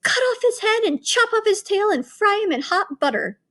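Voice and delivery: high, gleeful witch cries; gleeful witches. A narrator's reading. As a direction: relish every cruel step. Witches gloating.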